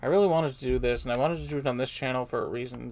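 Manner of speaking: ordinary talk, nothing marked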